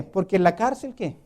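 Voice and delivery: usual speaking voice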